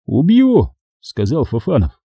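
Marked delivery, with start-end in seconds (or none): none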